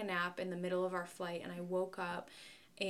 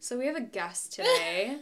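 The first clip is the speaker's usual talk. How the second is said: loud, sharp announcement; yelling; 8 dB louder than usual. Real, not acted. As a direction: call it out loudly to the room. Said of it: "What are you yelling for".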